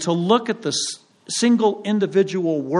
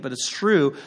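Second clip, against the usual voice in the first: normal talking voice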